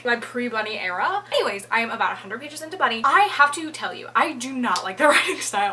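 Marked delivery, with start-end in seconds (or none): none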